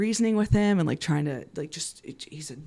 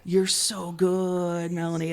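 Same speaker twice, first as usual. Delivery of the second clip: very slow, drawn-out praise; awed admiration; 4 dB quieter, much slower than usual. Retold or imitated. Girlfriend marvelling at Melanie spotting the sting, slow awe. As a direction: stretch it out in hushed wonder.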